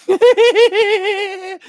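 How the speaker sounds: high squealing laugh; cracking up hard; burst of laughter. Real, not acted. Laughing at coworker's fart story.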